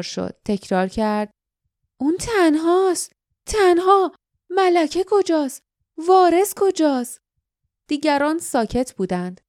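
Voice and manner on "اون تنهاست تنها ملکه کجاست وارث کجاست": put-on high-pitched, insistent young heroine; raised, emphatic alarm; urgent questioning. A narrator's reading. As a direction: press each short question, pitched well up. Jasmine, alert, asks pressing questions.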